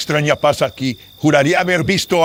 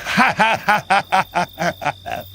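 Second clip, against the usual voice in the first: scheming villain's chuckle, pitched higher; gloating laugh; 4 semitones higher than usual. Acted. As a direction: relish the fortune you stole. Notary gloats over stolen gold. (risa burlona de villano)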